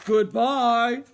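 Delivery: very loud Papa Bear call; big gruff voice. A narrator's reading. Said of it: "she heard a big gruff voice".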